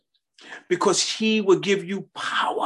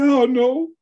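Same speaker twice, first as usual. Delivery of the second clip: high, loud tearful wail; crying; 12 semitones higher, 3 dB louder than usual. Retold or imitated. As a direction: re-enact crying out in helpless confusion. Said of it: "I would cry".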